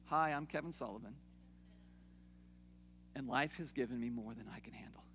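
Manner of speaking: soft, whispered support-group confession; hushed mock admission to the group. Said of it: "think of this like we're a big support group".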